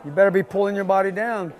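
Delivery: usual speaking voice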